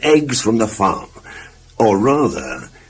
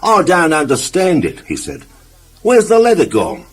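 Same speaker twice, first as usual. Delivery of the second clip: puzzled; 6 semitones higher than usual. Acted. Puzzled over missing letter.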